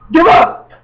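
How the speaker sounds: squealed give up; shrieked. Pretend. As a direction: yelp it out at the top of the voice. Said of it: "sudden shriek".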